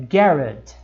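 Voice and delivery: "garage" with the stress on the first syllable, "GA-rage"; stress at the start. Shown as a demonstration. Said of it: "no começo".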